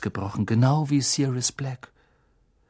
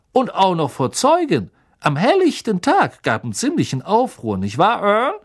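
higher, louder gossipy conductor voice; chatty bus conductor; 6 semitones higher, 4 dB louder than usual. Acted. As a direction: play Stan as an eager young gossip. Stan voiced by narrator.

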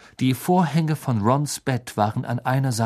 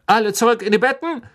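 fast, loud, high order; bossy prefect; 10 semitones higher, 6 dB louder, faster than usual. A narrator's reading. Head boy Percy ordering everyone to bed, quick and loud. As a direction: rush in barking orders. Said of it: "sagte Percy, der jetzt hereingerannt kam".

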